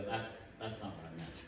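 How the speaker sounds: low, soft, exasperated aside; eye-rolling exasperation. Acted. God reacting to Pharisees' literal scripture boxes, quietly exasperated. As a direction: sigh it under your voice with an eye roll. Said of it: "kinda roll his eyes and go".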